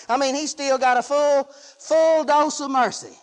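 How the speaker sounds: normal talking voice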